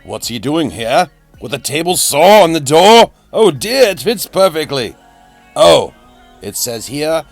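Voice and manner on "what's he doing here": normal talking voice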